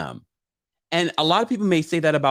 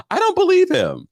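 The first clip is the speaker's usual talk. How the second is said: mock disbelief; 4 dB louder than usual. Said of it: "repeated disbelief".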